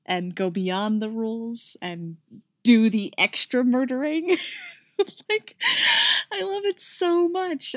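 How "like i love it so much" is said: much higher, delighted squeal; gushing. Real, not acted. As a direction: fangirl over the couple. Reader gushing about couple.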